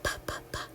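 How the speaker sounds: unvoiced pa pa pa like a train; breathy puffs for train sound; whispered puffing p. Shown as a demonstration. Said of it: "P goes with the train".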